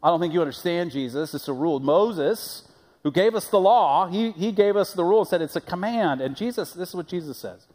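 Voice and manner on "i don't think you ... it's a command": smug legalist. Retold or imitated. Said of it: "In other words, like".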